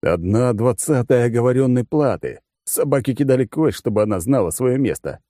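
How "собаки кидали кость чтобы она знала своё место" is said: ordinary delivery, nothing marked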